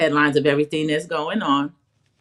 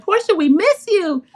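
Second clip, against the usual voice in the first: much higher, sing-out quote; production's gushing voice; 12 semitones higher, 3 dB louder than usual. Retold or imitated. Quoting production to the show's star, high imitation gushing. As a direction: imitate the producers cooing, pitched way up. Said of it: "And production said".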